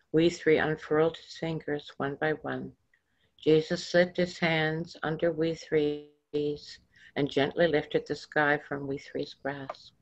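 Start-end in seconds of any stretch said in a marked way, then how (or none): none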